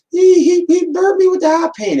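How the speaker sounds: very high, loud wailing tattletale; squealing kid faking a burn; whining kid. Retold or imitated. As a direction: wail like an overdramatic child. Whiny kid's exaggerated complaint.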